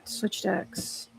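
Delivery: hushed whisper under the breath; whispered aside. Real, not acted. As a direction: whisper it to yourself. Aside to herself while switching decks.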